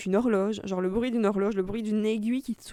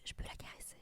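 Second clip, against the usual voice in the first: hushed whisper; 20 dB quieter than usual. Retold or imitated. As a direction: breathe the words without voice. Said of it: "tout le monde se parle tout bas". (chuchoté)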